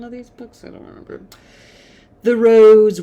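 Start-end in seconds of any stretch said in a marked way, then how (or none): 0.0-1.2 fading off into a near whisper; unsure, half-voiced afterthought; trailing mumble. Real, not acted. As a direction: mutter it to yourself, barely voiced. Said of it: "I feel like".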